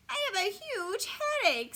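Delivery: high, loud whiny schoolkid; hammed-up whiny complaint; whining. A narrator's reading. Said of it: "she whines".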